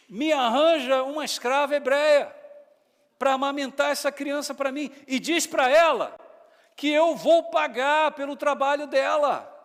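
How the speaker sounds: loud, high commanding princess; princess giving orders. Retold or imitated. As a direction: order the girl about like royalty. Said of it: "A princesa dando a ordem a Miriam". (ordem alta e imperiosa de princesa)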